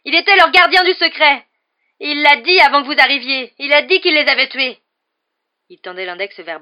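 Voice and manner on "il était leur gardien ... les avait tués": high, very loud accusation; furious yelling. A narrator's reading. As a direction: keep screaming the charges, voice pushed up. Harry goes on yelling at them.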